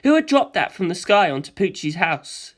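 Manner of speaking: usual speaking voice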